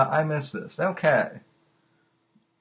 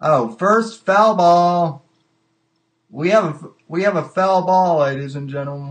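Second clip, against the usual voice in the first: loud, stretched play-by-play call; mock-grand announcement, raised and loud; mock sports announcer; 3 semitones higher, 7 dB louder than usual. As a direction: announce it big like a ballpark commentator.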